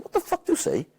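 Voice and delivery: high-pitched incredulous demand; indignant challenge. Retold or imitated. Manager confronting his sent-off player, high and indignant.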